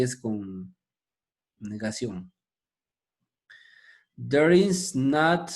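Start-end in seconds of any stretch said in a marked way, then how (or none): 4.2-5.5 very slow, drawn out "There is not"; slow teacher demo; slow careful reading. Shown as a demonstration. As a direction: stretch each word of "There is not" for learners. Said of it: "la negativa".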